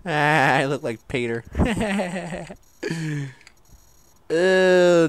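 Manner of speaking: ordinary talk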